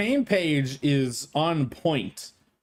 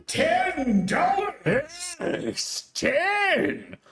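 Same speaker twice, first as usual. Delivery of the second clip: Irene voice; 13 semitones higher, much slower than usual. Acted. Reading superchat in Irene voice.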